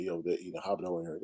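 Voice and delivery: ordinary delivery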